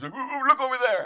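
high, loud, laughing urging; excited. Retold or imitated. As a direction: point him out eagerly. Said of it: "he grabbed [name] and goes".

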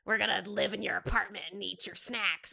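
a hint of a sly little cockroach. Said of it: "Do your cockroach voice".

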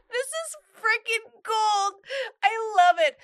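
high, slow, laughing delight. Real, not acted. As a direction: gush through the laughter. Delighted reaction while laughing.

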